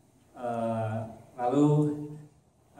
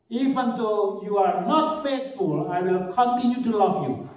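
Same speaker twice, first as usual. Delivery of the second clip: higher, very slow, lightly voiced promise; mock quote; 7 semitones higher, 3 dB louder, slower than usual. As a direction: act out the faithful lover slowly.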